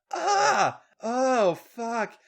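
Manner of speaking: high loud cry-out; acted high-pitched yell. A narrator's reading. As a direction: cry out far above the narration. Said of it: "Charlotte yelled".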